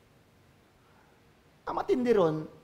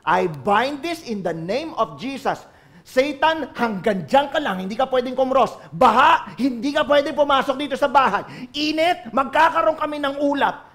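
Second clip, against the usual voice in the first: authority; 5 semitones higher, 4 dB louder than usual. Acted. Commanding in prayer.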